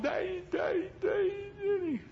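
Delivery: put-on high, crying shout of a scared boy; child crying for daddy. Little son under the doctor's board, crying out. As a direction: wail for Daddy under the stitches. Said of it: "he's got the thing over his head going".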